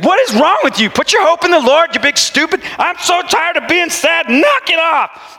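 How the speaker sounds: high, exasperated mock scolding. Preacher berating his sad soul, high mock scold. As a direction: nag your soul to snap out of it.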